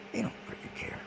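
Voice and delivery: bitter whispered aside. Real, not acted. Whispering to viewers that they don't care. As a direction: drop to a whisper, fed up. Aside about his tormentors.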